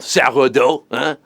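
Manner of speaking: hammed-up French accent; silly mock-French accent; ridiculous French accent. Shown as a demonstration. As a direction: say it like a cartoon Frenchman, French accent laid on thick. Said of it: "sourdough in a ridiculous French accent".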